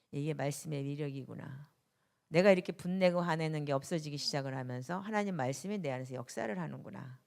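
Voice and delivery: normal talking voice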